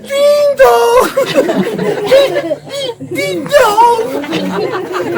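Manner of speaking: high chime sung through laughter; station chime. Pretend. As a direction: sing ding-dong, cracking up.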